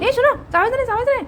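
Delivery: high-pitched doting prohibition; high, insistent pet-owner telling-off; scolding a pet. Real, not acted. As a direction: firmly correct a little pet in a high voice. Telling the pet not to chew.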